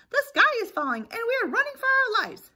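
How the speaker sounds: high, loud, fast panic; frantic alarm. Acted. Chicken Little warns Lucky Ducky, frantic high cry. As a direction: rush the warning out in cartoon terror.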